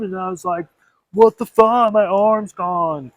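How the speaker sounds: mock surfer dude recounting an attack, rising to a yell; imitated surfer bro. A surfer bro tells of his arm being bitten off, mock panicked. Imagined shark victim's account.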